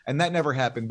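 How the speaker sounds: usual speaking voice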